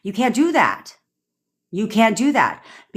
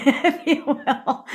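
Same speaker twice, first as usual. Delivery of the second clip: laughing; 10 semitones higher, much slower than usual. Real, not acted.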